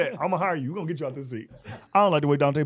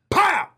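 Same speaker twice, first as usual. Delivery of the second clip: mock kick sound; 14 semitones higher, 6 dB louder than usual. Dante kicks the car.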